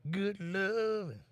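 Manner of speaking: high, very slow put-on Inuit accent; mock Inuit accent. Streamer reads a chat greeting in a high, slow mock Inuit accent. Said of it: "You sound like an Inuit".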